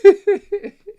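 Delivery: sudden loud high laugh; high burst of laughter. Real, not acted. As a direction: crack up at the memory. Laughing at the knockout.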